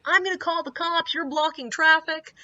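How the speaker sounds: high-pitched mock outrage; imitated screaming. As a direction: play the irate driver making threats. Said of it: "is screaming".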